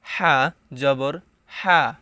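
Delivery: হা breathed from mid-throat, slow; throaty ha. Shown as a demonstration. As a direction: push হা from the middle of the throat. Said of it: "হাকে গলার ভিতরে নিয়ে পড়তে হবে".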